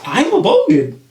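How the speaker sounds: excited. Real, not acted. Repeats joke confession, much higher.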